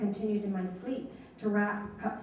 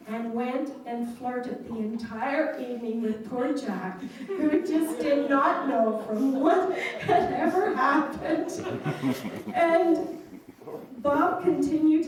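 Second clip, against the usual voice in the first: louder, slow, laughing through the punchline; can't hold back the laugh at poor Jack; laughing while telling; 3 semitones higher, 4 dB louder than usual. Real, not acted. Daughter recounting father's prank on Jack, laughing.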